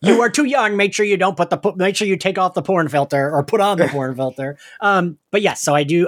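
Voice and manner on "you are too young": usual speaking voice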